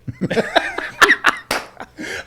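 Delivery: big burst of high laughter; cracking up hard; loud high laugh. Real, not acted.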